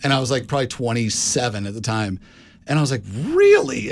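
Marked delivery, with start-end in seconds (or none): 3.3-3.9 high, loud incredulous squeal; retold astonishment. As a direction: react with exaggerated disbelief, pitch leaping way up. Retold astonished reaction to reading.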